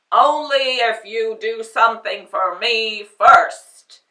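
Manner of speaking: loud sharp booming snap; snapped. Acted. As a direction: snap back impatiently. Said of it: "it snapped".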